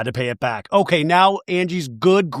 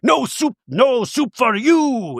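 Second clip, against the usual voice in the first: high, drawn-out scolding refusal; Soup Nazi; 9 semitones higher, slower than usual. Retold or imitated. Quoting the soup guy refusing a customer, mock scolding. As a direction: bark it as a hammed-up sitcom tyrant. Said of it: "They call him the soup Nazi".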